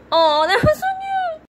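very high, loud squeal of delight. Real, not acted. Watching her pet with the plush, squealing high and loud. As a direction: squeal it out, totally charmed.

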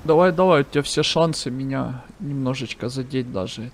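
normal talking voice

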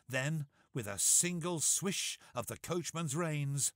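usual speaking voice

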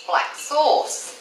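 ordinary delivery

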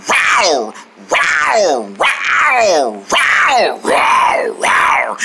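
repeated drawn-out "rawr" growls; tiger roars. Acted. Showing off favourite cat, roaring. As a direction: roar like a tiger, over and over.

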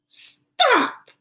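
high little voice saying Stop; child saying stop. Acted. [name] copying the calming step, high voiced. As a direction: echo the teacher as the child.

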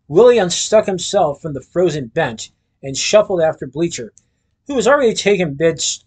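plain reading voice

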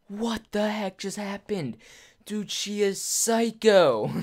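high, slow mock disbelief; in shock. Telling his friend after the mugging, high-pitched shocked disbelief. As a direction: stretch it out in hammed-up stunned amazement. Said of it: "in shock of what just happened".

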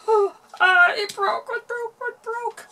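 high, loud panicked cry; dismayed cry. Real, not acted. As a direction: cry out as it breaks. Bracelet snaps, cries out.

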